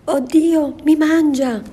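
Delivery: high, loud shriek of fright; terrified scream. Acted. Grandmother screaming as the wolf attacks her. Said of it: "Gridò la poveretta".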